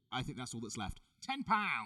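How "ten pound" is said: loud, higher promo voice; scripted ad read. Pretend. Hosts promoting ticket prices, hammed-up scripted ad voice. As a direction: read it like a teleprompter ad. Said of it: "Straight off the teleprompter".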